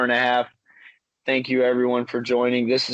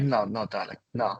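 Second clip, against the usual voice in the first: lowered prissy artiste; 6 semitones lower, 7 dB quieter than usual. Retold or imitated. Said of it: "he's like".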